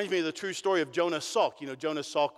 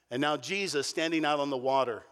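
ordinary delivery

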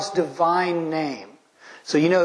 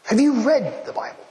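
incredulous retort; 7 semitones higher than usual. Real, not acted. Rebuking a skeptic.

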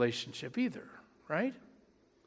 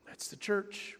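whispered; 12 dB quieter than usual. Real, not acted. Sermon's quiet closing line.

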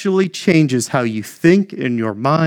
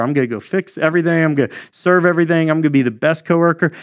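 eager rush; faster than usual. Retold or imitated. Mock eager over-functioning believer.

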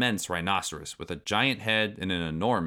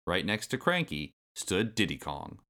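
ordinary delivery, nothing marked

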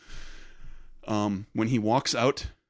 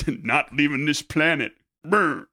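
slow, mock-grumpy refusal; lightly acted grump with a sputter; grumpy old Luke; 3 semitones higher, 4 dB louder, slower than usual. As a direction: play a cranky hermit digging in. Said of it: "'Cause he's grumpy".

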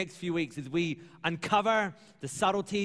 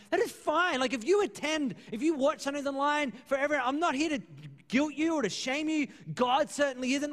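emphatic reassurance; 7 semitones higher than usual. Retold or imitated. Pastor answers emailers reassuringly.

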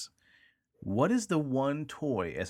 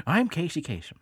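put-on radio countdown host voice, slightly raised; Casey Kasem; 4 semitones higher than usual. Podcaster joking about his broadcast voice, light impression. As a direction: lightly do a famous radio presenter. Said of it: "put on my Casey Kasem voice".

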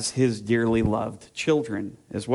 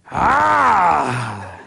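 long, loud high holler; sustained sudden yell, falling at the end; imitated child's yell; 5 semitones higher, 7 dB louder than usual. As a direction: holler like a toddler copying his loud dad. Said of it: "loudly talking to them".

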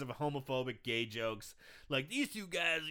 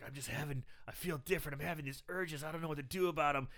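subdued confession; 7 dB quieter, faster than usual. Retold or imitated. Acted confession of urges to coach.

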